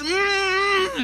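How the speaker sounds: high muffled cry through clenched teeth; high muffled whimper. Acted. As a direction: cry out with the rope in his mouth. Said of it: "decía".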